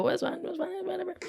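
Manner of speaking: high, soft, put-on dismissive voice; a thin, high, breathy brush-off; sarcastic aside. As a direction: shrug it off in a falsetto.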